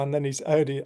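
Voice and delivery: ordinary talk, nothing marked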